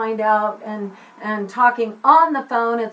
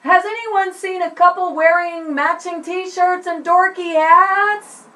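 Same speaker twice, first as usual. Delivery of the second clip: high call, rising louder at the end; acted lost kid calling for help; 7 semitones higher, 3 dB louder than usual. Shown as a demonstration. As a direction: appeal to a whole crowd of passers-by. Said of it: "then they call out".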